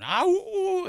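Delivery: high, loud werewolf howl; wolf howl. Retold or imitated. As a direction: howl like a wolfman. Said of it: "he would go full Wolfman makeup".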